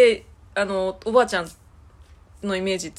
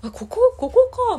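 put-on high, startled double take at the old house; a sudden high-pitched "oh, is this it?"; surprised; 14 semitones higher, 5 dB louder, slower than usual. Returning after ten years, surprised not to recognise the house. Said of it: "みたいな感じで".

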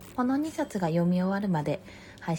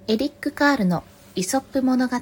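higher, more projected title announcement; storybook title read-out; 5 semitones higher, 7 dB louder than usual. Real, not acted. Reader opening storytime for listeners, projected title read-out.

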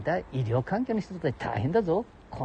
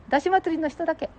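much higher put-on voice; falsetto second voice; 12 semitones higher than usual. Companion adds who was there, high falsetto. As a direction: chirp it in falsetto.

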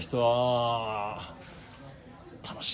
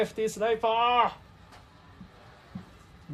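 loud cheering; 10 semitones higher, 4 dB louder, much slower than usual. Real, not acted. Cheering batter on live.

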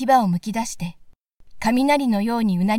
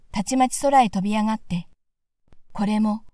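ordinary delivery, nothing marked

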